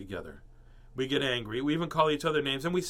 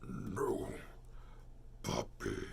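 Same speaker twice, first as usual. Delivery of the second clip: No puppy said low, soft and fearful; 7 semitones lower, 10 dB quieter, much slower than usual. Shown as a demonstration. Reader redoes the line for the child, low and fearful. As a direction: whisper No puppy as if scared. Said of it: "No, it's said with fear".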